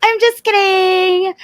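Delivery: high laughing I'm just kidding. Real, not acted. Laughing to J J that she's only kidding. Repeats the joke disclaimer.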